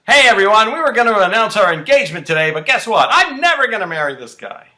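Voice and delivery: loud, high angry shout; Eden, angry announcement. Retold or imitated. Eden grabs the microphone at the party, angry shouted announcement. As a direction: yell it furiously to the whole room. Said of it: "very angry with Cruz".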